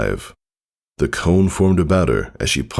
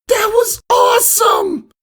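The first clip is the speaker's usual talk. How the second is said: very high, loud excited shout; awesome cheer; 4 dB louder than usual. Acted. Filly Scootaloo yelling her amazement at Tycho. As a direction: shriek it with pure delight.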